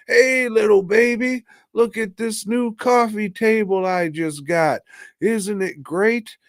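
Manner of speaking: put-on high sing-song dad voice to a baby; hammed-up cooing, slowing on the last words; excited baby talk. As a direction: show off to a baby, pitched right up. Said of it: "baby starts crying. He picks it up".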